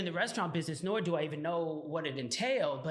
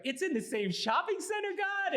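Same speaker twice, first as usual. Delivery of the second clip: astonished question to God; 13 semitones higher than usual. Retold or imitated. Man spotting the lease sign, astonished and climbing high. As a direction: pitch climbing high in disbelief.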